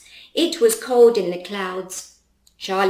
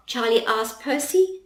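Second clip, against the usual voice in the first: usual speaking voice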